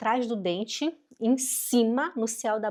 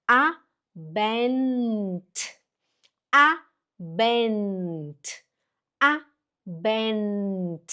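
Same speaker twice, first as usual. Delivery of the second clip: "Abend" stretched out with a long held n; very slow "A-bennn-t" for learners; slow practice; much slower than usual. Shown as a demonstration. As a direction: draw "Abend" out syllable by syllable. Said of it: "A, devagar primeiro".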